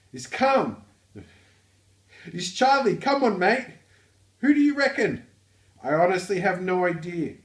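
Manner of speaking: loud, high-pitched Australian; Australian accent. Acted. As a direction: belt it out in a broad, hammed-up Aussie accent. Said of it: "the work known as the Australian accent".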